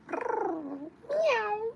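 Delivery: very high, loud squeaky meow; playful kitty meow; cat meow. Pretend. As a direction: mew like a cute kitten. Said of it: "I am a beautiful and cute cat".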